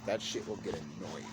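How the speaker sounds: plain reading voice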